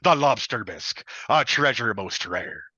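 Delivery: loud hearty pirate announcing treasure. Acted. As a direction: boom it out like a ship's captain. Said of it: "do my best pirate".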